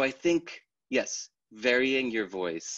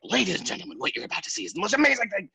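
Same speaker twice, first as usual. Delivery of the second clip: higher, fast hammed-up barker; carnival barker caricature; 11 semitones higher, 4 dB louder, faster than usual. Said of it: "caricature of the carnival barker".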